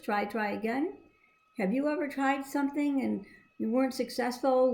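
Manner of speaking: plain reading voice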